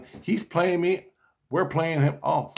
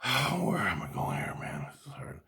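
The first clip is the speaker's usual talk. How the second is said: whisper; 7 dB quieter than usual. Real, not acted. Struggling aside to himself.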